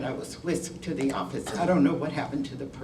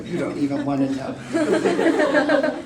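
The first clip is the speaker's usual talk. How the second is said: slow, high, loud real laughter through words; laughing; 8 semitones higher, 6 dB louder, slower than usual. Real, not acted. Speaker joking about old phones, really laughing. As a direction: break up laughing as you speak. Laughs at how long ago.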